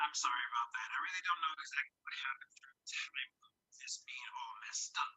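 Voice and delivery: ordinary delivery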